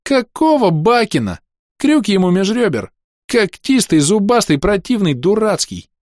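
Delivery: put-on very high, indignant little girl's voice; high-pitched, protesting name-calling; squeaky protest. A narrator's reading. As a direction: squeal insults in a child's tantrum. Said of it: "протестующе пискнула Роска".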